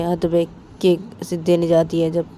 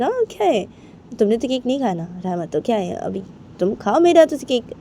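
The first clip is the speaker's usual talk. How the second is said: high, insistent coaxing with a teasing "हाँ?"; coaxing friend; 7 semitones higher than usual. Acted. Bani coaxing Rama to eat cake, high and insistent. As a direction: needle her into eating the cake, pitched up.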